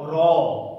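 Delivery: "را" thick and full, with a heavy trilled r. Shown as a demonstration. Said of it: "اس را کے لفظ کو موٹا".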